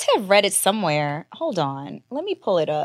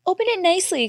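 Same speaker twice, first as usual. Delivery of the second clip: fussy parent voice; 11 semitones higher, faster than usual. Retold or imitated. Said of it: "some parents that are like".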